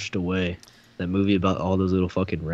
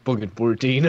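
ordinary delivery

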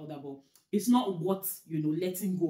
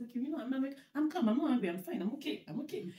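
mock insisting calm; 6 semitones higher than usual. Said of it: "I'm like".

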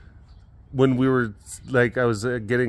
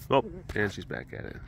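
ordinary talk, nothing marked

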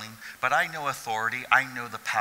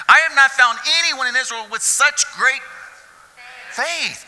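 Jesus marvelling at the centurion's faith; Jesus proclaiming; 10 dB louder than usual. Acted. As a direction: declare it like Jesus astonished. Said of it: "the horsies".

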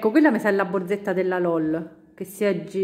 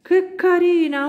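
high, loud delighted cry; 9 semitones higher, 4 dB louder than usual. Real, not acted. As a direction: coo with delight. Delight at colour change.

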